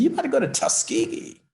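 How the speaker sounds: a hint of an overjoyed father; proud father. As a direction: voice the father bursting with pride. Proud father speaks to son.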